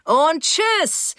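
high, gleeful send-off; triumphant, happy call. A narrator's reading. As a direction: crow it in triumph. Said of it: "rief er triumphierend und glücklich".

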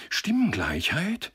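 high, drawn-out startled question; blurted question. A narrator's reading. Leader interrupting the count, blurting in alarm. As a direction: burst out in disbelief. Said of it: "stieß der Vorsteher hervor".

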